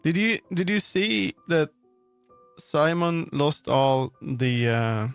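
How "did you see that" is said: ordinary talk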